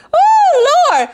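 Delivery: high, loud "oh Lord"; amused exclamation. Real, not acted. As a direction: cry out high after cracking yourself up. Exclaiming after laughing at herself.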